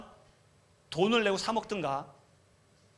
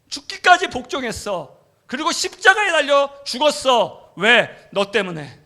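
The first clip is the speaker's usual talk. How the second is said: much higher and louder, building; preaching at full force; 6 semitones higher, 8 dB louder than usual. Acted. God telling the preacher why, thundering. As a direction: declare it with full power. Climax of God's words.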